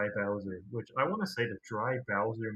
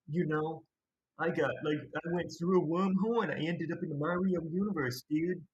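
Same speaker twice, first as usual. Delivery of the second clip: higher, over-the-top cartoon action hero; 5 semitones higher than usual. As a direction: play it like a cocky cartoon hero. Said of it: "He kinda talks like that".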